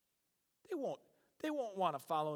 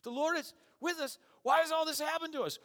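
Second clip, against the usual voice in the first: high mocking sarcastic complaint, louder; 12 semitones higher, 5 dB louder than usual. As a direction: sneer at the angel's promise. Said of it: "I see Gideon being very sarcastic".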